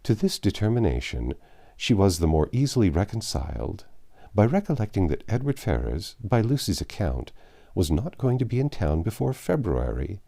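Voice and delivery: ordinary delivery, nothing marked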